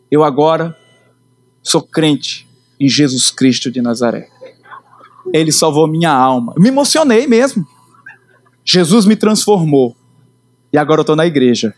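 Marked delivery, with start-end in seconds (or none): 0.1-6.5 low, slow, grave declaration; solemn testimony. Retold or imitated. Telling his older brother he is now a believer, low and grave. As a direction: announce your faith gravely.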